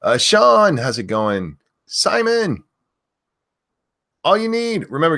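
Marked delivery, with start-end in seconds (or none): 0.0-0.8 loud high name shout; screaming names. Real, not acted. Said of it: "I have to stop screaming that". 1.8-5.2 screaming names. Real, not acted. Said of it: "I have to stop screaming that".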